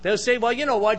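ordinary delivery

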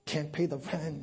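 normal talking voice

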